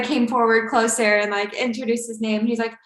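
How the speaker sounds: ordinary talk, nothing marked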